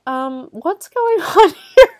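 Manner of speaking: high, loud mock-bewildered question, breaking into laughter; slow um, then climb into a laughing, baffled squeak; Craig. Craig walking in on the mess, bewildered high question. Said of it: "And Craig is like".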